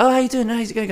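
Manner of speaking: high, put-on friendly voice; mock eager greeting. Friend greeting the barmaid in a high, eager put-on voice. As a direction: play up the friend's over-eager hello.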